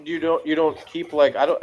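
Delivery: ordinary talk, nothing marked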